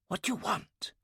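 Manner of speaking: high, soft frightened whisper. A narrator's reading. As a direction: breathe the question out in fear. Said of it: "she whispered".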